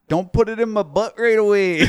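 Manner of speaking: high, loud imitation of a Minnesotan wife; thick Minnesota accent. Husband retells his wife's line from early dating, thick Minnesota accent. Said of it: "very thick accent".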